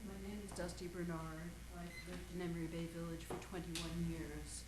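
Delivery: faint, distant off-mic voice; speaking away from the microphone; far from mic. Real, not acted. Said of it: "hold the mic closer to your mouth".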